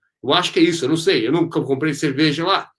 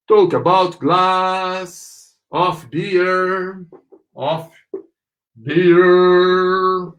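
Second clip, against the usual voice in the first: very slow, vowels held long; drawn-out model phrase; much slower than usual. Shown as a demonstration. Teacher demonstrating the phrase for learners, drawn out. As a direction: stretch "glass" and "beer" right out.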